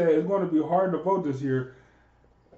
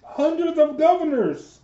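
higher, slowed, mocking repeat; incredulous scoff; 7 semitones higher than usual. Real, not acted. Scoffing at Trump's governors claim. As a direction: drag it out in disbelief.

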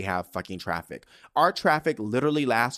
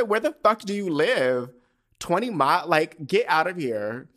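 loud, higher, incredulous challenge; retold outburst of scornful disbelief; berating; 5 semitones higher, 4 dB louder than usual. Woman replays challenging her date on where he lives, loud incredulous berating. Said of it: "I'm fucking berating the man".

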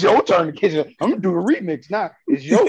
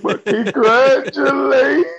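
mock crying; 11 semitones higher, 7 dB louder, slower than usual. Mock-tearful congrats on ex's pregnancy.

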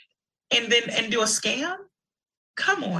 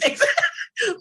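high bright laugh; quick high laugh; burst of laughter; 11 semitones higher than usual. Real, not acted. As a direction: laugh out at the joke. Laughing at wet-the-whistle joke.

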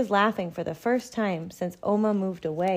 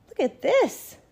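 1 excited mother; 12 semitones higher, 6 dB louder than usual. A narrator's reading. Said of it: "my mother says".

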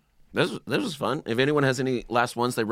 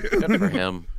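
chuckling through it, pitched higher; laughing; 8 semitones higher, slower than usual. Real, not acted. Amused host repeating his own line, laughing.

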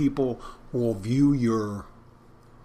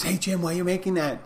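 higher-pitched imitation of a customer calling out; mock yell; 8 semitones higher than usual. Customer interrupting the bartender mid-order, mock yell. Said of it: "someone yells at me and goes".

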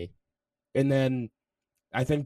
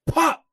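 slap sound; 9 dB louder than usual. Retold or imitated. Acting out the slap.

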